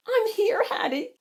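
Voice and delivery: high, anxious character voice; crying out. A narrator's reading. Pearl answering Hattie's call, crying out high. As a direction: pipe up in a small high voice. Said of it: "Pearl cried".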